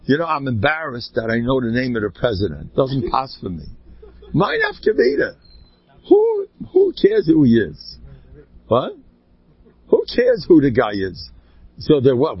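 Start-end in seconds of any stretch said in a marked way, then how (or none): 4.3-11.3 scoffing cry. Acted. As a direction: throw the question up high in disdain. Preacher scoffing at politics.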